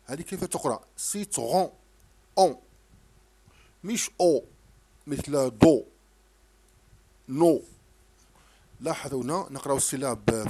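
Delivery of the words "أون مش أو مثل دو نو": each syllable called out much louder, one by one; loud drilled syllables. Shown as a demonstration. Teacher drilling a child on syllables, loud and separate. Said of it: "هذا الصوت غير موجود".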